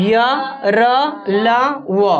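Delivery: "या, र, ला, व" sounded one by one, drawn out; letter-sound demonstration. Shown as a demonstration. Teacher showing students how semivowels sound, letter-sound demonstration. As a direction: say "या, र, ला, व" slowly as separate letter sounds. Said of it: "बोल करके भी देख सकते हो".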